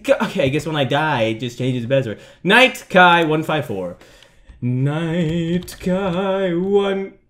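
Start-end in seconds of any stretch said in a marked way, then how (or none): 4.6-7.2 very slow, word by word as if typing; drawn-out typing. Real, not acted. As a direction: stretch every word out slowly. Slowly re-entering the password while typing.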